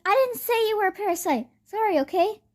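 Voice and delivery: high-pitched flustered apology; acted hasty, defensive sorry; defensive protest. Defensive apology to offended Venom.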